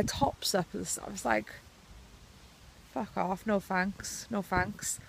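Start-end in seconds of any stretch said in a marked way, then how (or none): none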